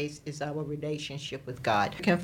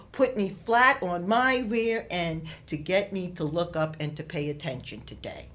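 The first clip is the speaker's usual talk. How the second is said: ordinary talk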